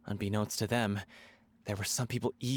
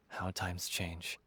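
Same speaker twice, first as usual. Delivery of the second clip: sigh, then a slow, much softer line; sigh of regret; 5 semitones lower, 8 dB quieter, much slower than usual. Acted. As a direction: let it fall away with a sigh of loss. Wistful remembrance of old days.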